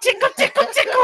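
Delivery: high giggling tickle tickle; giggly tickle attack. Pretend. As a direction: squeal it out through giggles. Mimics parrot tickling, laughing.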